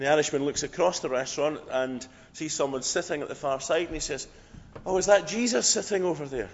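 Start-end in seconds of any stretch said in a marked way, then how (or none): 4.8-6.5 lightly Irish, higher and louder; a half-hearted go at an Irish voice, pitched up; attempted Irish accent. Irishman spotting someone across the restaurant, attempted Irish accent. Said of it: "an Irish accent".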